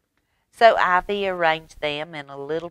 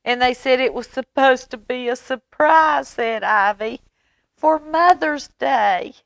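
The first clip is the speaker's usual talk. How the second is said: crying; 9 semitones higher, 5 dB louder than usual. Acted. Ivy tearfully telling Mama it was meant as a surprise, crying. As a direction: whimper it out in tears. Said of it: "started to cry".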